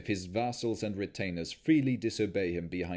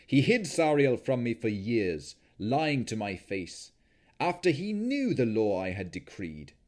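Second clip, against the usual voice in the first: louder, wounded lord's accusation; bitter anger; 3 dB louder than usual. Acted. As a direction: spit out the betrayal. Strahd bitter and angry.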